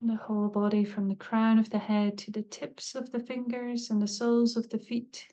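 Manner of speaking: calm, unhurried meditation voice with long pauses; guided meditation. Acted. Teacher leading listeners into a body scan, calm meditative guiding. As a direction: guide them gently, leaving space between phrases.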